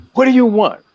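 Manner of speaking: mock shout. Shown as a demonstration. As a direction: call "What do you want?" out like from a rooftop. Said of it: "shout it from the rooftop".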